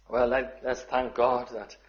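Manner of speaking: usual speaking voice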